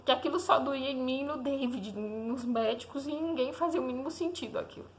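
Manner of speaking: real tearful strain, voice climbing high; choked up. Real, not acted. Mother reliving her baby nearly dying. (voz embargada, quase chorando)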